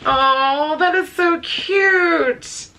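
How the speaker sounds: very high, slow, stretched-out cute; gushing. Real, not acted. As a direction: melt over the little koala. Sees koala gift.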